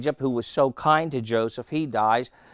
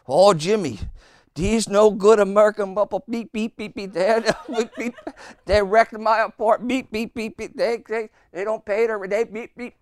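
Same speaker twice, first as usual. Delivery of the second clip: high, loud ranting with bleeped curses; furious cursing neighbour; 7 semitones higher, 4 dB louder than usual. Retold or imitated. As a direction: rant like a man whose tenants wrecked the place. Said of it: "He had a string of curse words".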